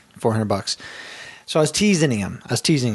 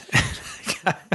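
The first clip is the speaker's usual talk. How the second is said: breathy laugh; 7 semitones higher, 4 dB quieter than usual. Real, not acted. Laughing at prank.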